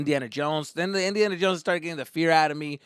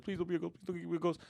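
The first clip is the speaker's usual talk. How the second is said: soft, higher hurried plea; retold pleading; 4 semitones higher, 9 dB quieter, faster than usual. Boy with eyes shut on ride, soft scared pleading. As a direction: repeat it timidly under your breath.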